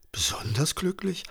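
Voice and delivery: soft, high questioning echo; mildly irritated, hushed query; puzzled. A narrator's reading. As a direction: repeat it under the breath as a baffled question. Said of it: "fragte Johannes irritiert".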